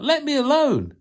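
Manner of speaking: high pleading yell; shrill-pitched plea to be let alone. Acted. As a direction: cry out to be left alone. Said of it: "and I yelled out".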